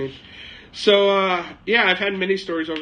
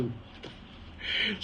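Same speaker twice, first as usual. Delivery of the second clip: stunned whisper; 4 semitones higher, 11 dB quieter, much slower than usual. Retold or imitated. Shocked at the insult.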